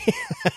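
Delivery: quick high laugh; a sudden bemused laugh; short burst of laughter. Real, not acted. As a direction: laugh out in surprise. Laughs at confusing statement.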